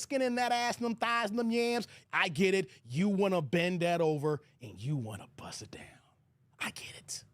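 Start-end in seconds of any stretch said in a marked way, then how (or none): 4.6-7.2 unvoiced lewd whisper. Real, not acted. Hushed whisper to the viewers about men's urges. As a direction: drop to a whisper for the dirty part.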